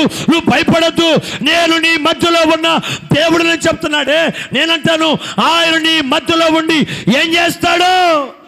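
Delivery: loud, high preaching to the congregation; full-force preaching. Acted. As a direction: preach it out, rousing the crowd.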